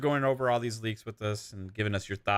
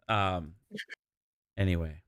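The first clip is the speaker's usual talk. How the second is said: usual speaking voice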